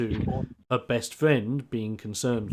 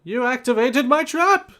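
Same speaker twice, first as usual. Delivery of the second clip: high, loud theatrical announcement; spring the trap with a showy shout; triumphant villain cry; 10 semitones higher, 6 dB louder than usual. Acted. Game master suggesting the doctor's line, triumphant villain cry. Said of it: "It's like".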